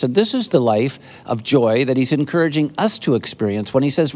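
ordinary talk

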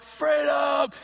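loud, high shout of the crowd; crowd battle shout. Acted. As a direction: cheer it out as the army. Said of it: "And then afterwards they're like".

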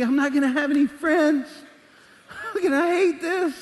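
high, loud comic whine of dismay; high-pitched, exaggerated dread; mock dismay. Student's dismayed reaction in Bible school.

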